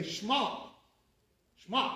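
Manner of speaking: "Shema" run together as "shma", no vowel after the sh; clipped one-syllable Shema. Shown as a demonstration. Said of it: "They say Shema".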